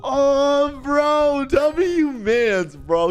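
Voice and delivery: very high, elated cheer; gushing delight. Real, not acted. Streamer cheers the man sticking up for him, gushing. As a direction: gush over your hero in a high pitch.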